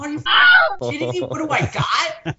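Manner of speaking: high, laughing disbelief; disbelieving. Retold or imitated.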